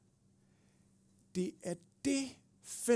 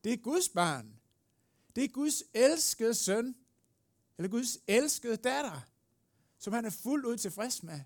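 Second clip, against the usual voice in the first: put-on loud, high-pitched defence of a child; impassioned advocate; 6 semitones higher, 5 dB louder than usual. Advocate defending God's child from the accusers, loud and impassioned. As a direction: cry out the verdict with conviction. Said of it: "Og så siger han".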